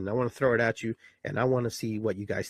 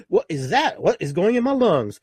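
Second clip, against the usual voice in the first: higher, louder bewildered cry; alarmed baby; 8 semitones higher, 6 dB louder than usual. Retold or imitated. Newborn feeling air in its lungs, alarmed and louder. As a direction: blurt it out, alarmed. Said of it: "all of a sudden you're going".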